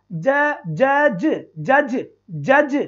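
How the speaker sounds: "जज" very slowly, sound by sound; slow teacher's breakdown of "जज". Shown as a demonstration. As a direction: stretch "जज" so each ज is heard separately. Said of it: "अब देखो, यहाँ पर देखना बेटा".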